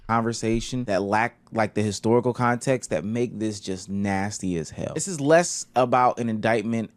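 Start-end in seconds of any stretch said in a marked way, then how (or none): none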